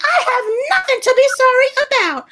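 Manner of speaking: very high, put-on haughty voice; high-pitched mocking indignation; mock indignant refusal. Mocks the woman refusing to apologize.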